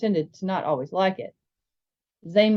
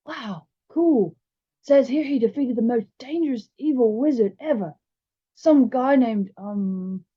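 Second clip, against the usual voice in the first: higher-pitched, earnest schoolboy voice; impressed bigger boy; 5 semitones higher, slower than usual. Acted. Bigger boy reads about the evil wizard, boyishly impressed. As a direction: play an awed boy reading out facts.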